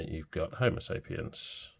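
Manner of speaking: ordinary delivery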